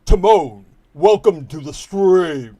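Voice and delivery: loud, drawn-out booming announcer voice; deep booming voice. Shown as a demonstration. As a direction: boom it out big and slow. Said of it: "I'm just gonna be really deep".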